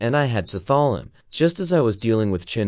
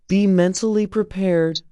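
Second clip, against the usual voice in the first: higher voice, grave warning; warning doctor; 9 semitones higher than usual. A narrator's reading. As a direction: caution them like a weary doctor. Doctor warns on the phone.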